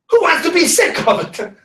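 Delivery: high, loud, laughing; laughing high retort. Retold or imitated. Elderly client scoffs at being sick, laughing high. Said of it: "old client's retort".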